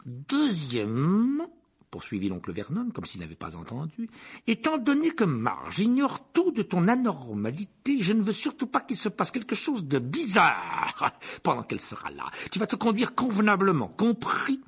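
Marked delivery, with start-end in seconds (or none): 0.1-1.5 loud, drawn-out, high announcement; stern uncle. Acted. Uncle lays down rules sternly. 4.5-14.6 domineering uncle. Acted.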